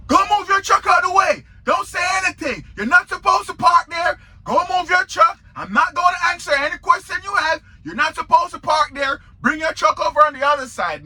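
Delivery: retold shouting clerk, fast and high; retold yelling. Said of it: "the way she yelled at me".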